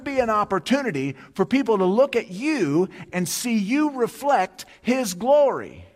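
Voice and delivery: high, loud, driving sermon delivery; impassioned preaching. Real, not acted. Preacher urging congregation any encounter counts, high impassioned preaching. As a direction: drive each example home to the congregation. Preacher builds to full force.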